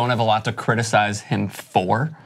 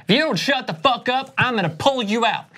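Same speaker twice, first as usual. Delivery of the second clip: angry coach; 10 semitones higher than usual. Retold or imitated. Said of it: "He's like".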